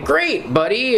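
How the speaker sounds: high, chirpy ventriloquist voice; squeaky ventriloquist voice. Retold or imitated. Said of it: "Where he's, like, a ventriloquist dummy".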